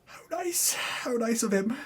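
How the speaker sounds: high, mock-sweet sarcasm; a sugary, sneering little aside; sarcastic. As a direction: coo it in fake admiration. Narrator's sarcastic aside on the burial.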